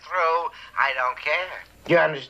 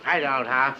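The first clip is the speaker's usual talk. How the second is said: usual speaking voice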